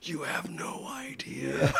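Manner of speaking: slow, drawn-out whisper; confiding whisper. Retold or imitated. Man retelling talk of angels to volunteer, amused whisper. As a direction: whisper it like a huge secret, amused.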